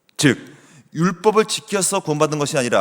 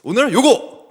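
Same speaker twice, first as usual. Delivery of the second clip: cheerful dad; 7 semitones higher, 6 dB louder than usual. Retold or imitated. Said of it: "제가 기분이 좋아지면".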